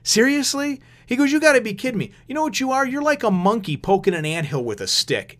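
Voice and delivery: high mocking taunt. Toriko mocking the robot operator, lightly voiced taunt. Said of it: "he starts making fun of him".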